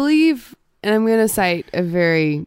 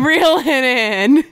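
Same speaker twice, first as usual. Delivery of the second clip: slow laughing plea; 8 semitones higher, 6 dB louder, much slower than usual. Real, not acted. Repeats laughing, slower.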